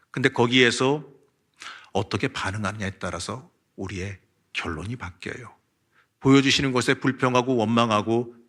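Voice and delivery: normal talking voice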